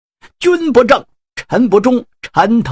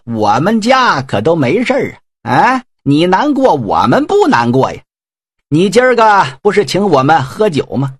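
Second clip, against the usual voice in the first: mocking taunt; 3 semitones higher than usual. Generals needle their grieving elder brother with cheeky laughter. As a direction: goad him with carefree jeers.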